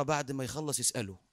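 low and soft, under the breath; hushed reply. Acted. Neighbour answering Samara during the sermon, hushed and low. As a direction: keep it down, a low murmured answer.

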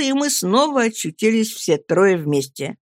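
ordinary delivery

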